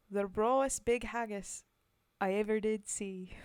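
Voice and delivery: put-on loud, rough try at a Scottish accent; attempted Scottish accent. Streamer reading a poem to chat in an attempted Scottish accent. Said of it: "I have an attempt at an accent".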